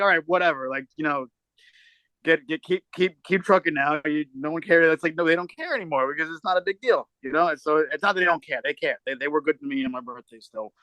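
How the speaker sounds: normal talking voice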